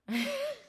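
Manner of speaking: short high burst of laughter. Real, not acted. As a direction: laugh at yourself. Laughs at her own stubbornness.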